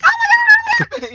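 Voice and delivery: very high, loud fangirl squeal; little-girl scream. Retold or imitated. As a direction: shriek it like an overexcited little girl. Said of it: "like, you wanna scream a little".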